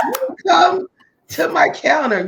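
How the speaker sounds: loud, high, fast indignant shout; exasperated outburst. Retold or imitated. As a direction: yell it as if he should know better. Continues the annoyed retort.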